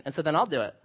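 ordinary delivery

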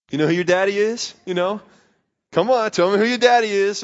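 playful coaxing. Retold or imitated. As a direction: coax her like a proud, goofy dad. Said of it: "I'd walk by and be like".

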